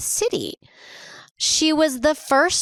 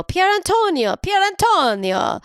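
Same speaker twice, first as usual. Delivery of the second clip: Italian accent; 10 semitones higher than usual. Shown as a demonstration. Trying out the name to her co-host, Italian accent. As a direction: roll out "Pier Antonio" with an Italian lilt. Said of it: "I think that was not bad".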